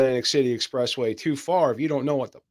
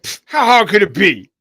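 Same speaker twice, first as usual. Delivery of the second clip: put-on cocky New York accent, louder; 4 semitones higher, 8 dB louder than usual. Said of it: "that was a urban accent".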